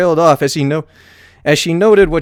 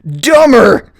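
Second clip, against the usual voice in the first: louder, higher taunting dumber; exclaiming; 8 semitones higher, 7 dB louder than usual. A narrator's reading. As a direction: shout it gleefully. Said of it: "Spike exclaimed".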